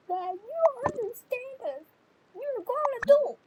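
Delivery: high, loud, tearful complaint; crying protest. Pretend. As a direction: protest to dad like a crying teen. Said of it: "she's crying right now".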